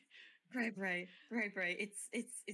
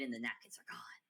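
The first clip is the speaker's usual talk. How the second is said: whispered; 4 semitones lower than usual. Acted. Whispered reveal.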